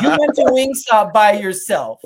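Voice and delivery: loud, slow disbelief; incredulous. Retold or imitated. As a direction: act stunned he ate alone. Wife incredulous at eating alone.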